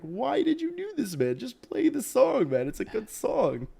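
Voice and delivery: high-pitched mock protest; imitated incredulous onlookers. Onlookers scolding the bartender over the song, imitated incredulous protest. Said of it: "all these people are just looking at him like".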